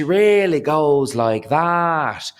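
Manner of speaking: very slow, high, stretched vowels; exaggerated lilting drawl, pushed up high; drawn out. Shown as a demonstration. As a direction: draw every word out in a melodic swoop. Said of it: "she draws everything out".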